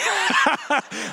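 a short high burst of laughter; high giggle. Real, not acted. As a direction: laugh at yourself. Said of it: "I'm a child".

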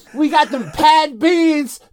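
slow Southern drawl; mock Southern twang. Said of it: "You're going all Southern now".

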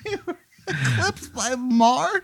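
high, slow question squeezed through laughter; laughing disbelief. Real, not acted. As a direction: barely get the absurd question out laughing. Player cracks up in disbelief.

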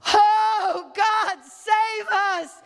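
high, slow wail for rescue; acted desperate cry. Flood victims begging God to save them, desperate wail. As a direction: wail to God, stretching each word.

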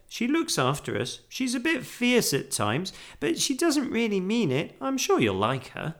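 put-on high-pitched, friendly girl; young Judy. A narrator's reading. Girl preparing bear for housekeeper, friendly. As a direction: chat brightly, pitched well up.

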